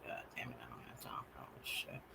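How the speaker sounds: fast annoyed whisper; hissed exasperation; frustrated whisper. Real, not acted. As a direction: grumble under breath. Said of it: "fumbling with buttons".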